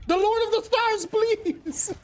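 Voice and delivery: high dramatic plea; high pleading. Pretend. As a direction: beg it up high, theatrically. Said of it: "Star-Lord".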